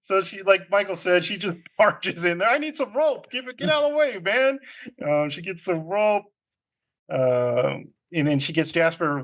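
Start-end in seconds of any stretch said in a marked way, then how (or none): 2.5-4.6 high-pitched, pushy order; hammed-up impatient command; mock demanding. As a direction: barge in and demand it, played for comedy. Acts out marching in demanding rope.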